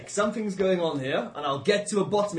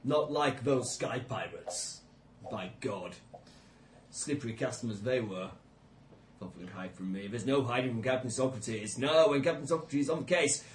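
put-on low, blustering old adventurer; gruff sea-captain bluster, low; 7 semitones lower, 5 dB quieter than usual. Player voicing his captain.

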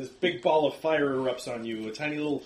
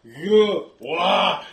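loud gasping orc roar; orc pained roar; 3 semitones higher, 12 dB louder than usual. Acted. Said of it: "Ugluk's like".

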